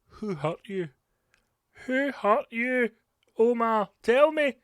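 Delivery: rising to a high, loud pleading call; mock caring plea building to a loud high cry; mock therapist plea. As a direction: coax the bigot like a hammed-up counsellor. Mock tender therapist plea to bigot.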